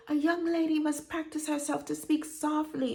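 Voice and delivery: put-on high-pitched grandmother with Panamanian accent. Grandmother correcting a young lady, Panamanian-accented admonishing. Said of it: "in her Panamanian accent".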